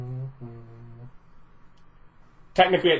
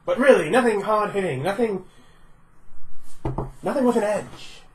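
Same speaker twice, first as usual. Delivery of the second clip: smarmy villain voice; 5 semitones higher than usual. Acted. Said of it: "I hate everything about this character you're doing".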